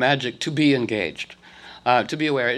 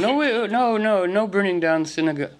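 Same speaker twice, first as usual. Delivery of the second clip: pitched up high, protesting no; a voiced party member pushing back, higher; objecting party members; 6 semitones higher than usual. Party members refusing the burnings, protesting voice.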